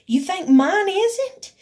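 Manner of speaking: slow, loud, high retold challenge; indignant retort. Answering her complaint about a racing heart, indignant.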